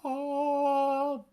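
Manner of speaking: long high held vocal noise; mock chainsaw wail. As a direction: imitate a revving chainsaw. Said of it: "his chainsaw is just like".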